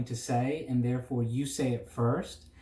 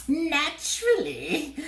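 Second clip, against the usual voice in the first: very high, slow cackle of a word; cackling bird; 3 dB louder, slower than usual. A narrator's reading. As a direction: crow it like a smug bird. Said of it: "he cackled".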